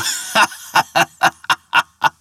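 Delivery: high breathless mocking cackle; jeering laugh. The ferryman laughing in scorn as Thor strides away. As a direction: laugh him off the bank. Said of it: "the jeering laugh of the ferryman".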